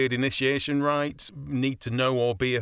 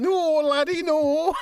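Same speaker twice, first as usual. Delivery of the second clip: much higher, louder jokey Scots voice; mock Scottish accent; 5 dB louder, slower than usual. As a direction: ham up a Scottish laddie. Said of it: "do your Scottish accent again".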